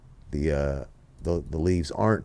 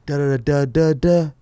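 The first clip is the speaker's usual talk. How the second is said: higher, louder placeholder name call; sing-song stand-in for a name; mock cop calling out; 9 semitones higher, 8 dB louder than usual. Filler for father's name called.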